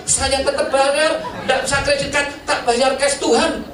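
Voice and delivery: preacher protesting to God that he did good; self-righteous defense. Retold or imitated. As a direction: argue your case to God like a proud child. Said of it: "Apa yang kamu banggakan?".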